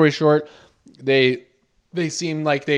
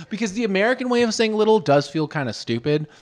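ordinary talk, nothing marked

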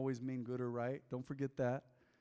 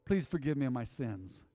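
leading prayer; 3 semitones higher, 6 dB louder than usual. Acted. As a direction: lead the room in prayer, phrase by phrase. Leads the sinner's prayer.

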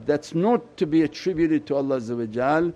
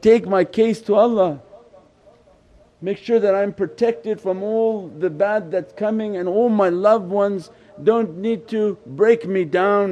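raised, urgent appeal; pleading; 6 semitones higher, 4 dB louder than usual. Acted. Worshippers crying at the threshold of the Prophet, pleading. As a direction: plead higher, stretching out the plea. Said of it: "They were crying at the threshold".

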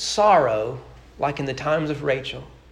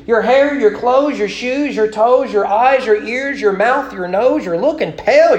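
higher, louder, gushing over every detail; gossiping townswoman; 6 semitones higher, 6 dB louder than usual. Acted. Bethlehem women gawking at Naomi's return, gossipy and high. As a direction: fuss over her like a nosy neighbor.

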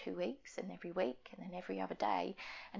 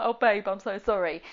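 louder, comforting friend voice; sympathetic friend; 11 dB louder than usual. Retold or imitated. Best friend consoling her, sympathetic. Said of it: "she was like".

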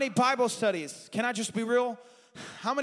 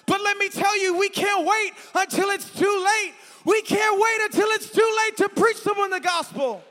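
higher, much louder preached warning; shouted preaching; 10 semitones higher, 6 dB louder than usual. Acted. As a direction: hammer the warning home.

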